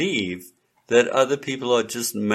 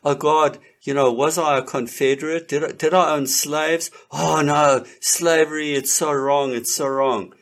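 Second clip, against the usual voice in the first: higher, louder hammed-up apology; overdone sorry, pitched up; mock grovelling; 4 semitones higher, 3 dB louder than usual. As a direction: play a cringing penitent for laughs. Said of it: "retreating and denying and bowing down".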